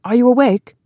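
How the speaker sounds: high, loud, timid call through a door; young man's call. A narrator's reading. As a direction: call gently through the wood. Young man calls through door.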